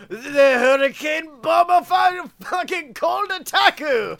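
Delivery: high, loud cartoon Japanese challenger; hammed-up Japanese battle cry; mock Japanese accent. Comic challenging a rival with a mispronounced name, mock Japanese accent. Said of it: "Japan in there".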